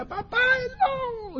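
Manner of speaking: grito agudo y alargado, con poca fuerza; llama a papá con un hilo de voz aterrada; grito de miedo. Acted. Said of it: "cuando salió comenzó a gritar". (niño gritando asustado, en falsete)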